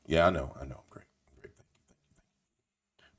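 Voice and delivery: low, soft reply fading into a whisper; mock modest thanks. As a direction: trail away to barely audible whispered thanks. Acting out being praised as strategist.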